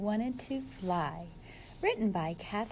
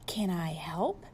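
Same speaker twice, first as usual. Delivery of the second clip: gentle polar bear offer; 6 semitones lower than usual. Acted. Polar Bear offering help to his friend, whispered. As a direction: whisper it kindly like a shy bear. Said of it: "asked Polar Bear".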